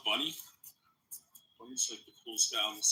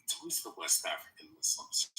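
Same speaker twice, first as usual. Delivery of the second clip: low, soft whisper; whispered aside; 7 semitones lower, 6 dB quieter than usual. Real, not acted. Host muttering a sly aside to the room, whispered. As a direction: breathe the line as a sly aside. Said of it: "whispered aside".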